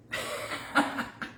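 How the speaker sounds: voiceless, wheezy chuckle; self-amused laugh. Real, not acted. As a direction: laugh off your own slip. Picked up the wrong piece.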